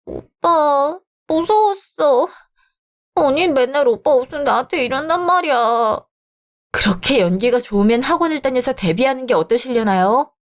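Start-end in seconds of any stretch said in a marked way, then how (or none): 0.1-6.0 put-on high, drawn-out babyish whine to big brother; whimpering tattletale. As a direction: play the frightened little victim, pleading high. Sister plays victim to her brother. (겁먹은 척 징징대며 이르기)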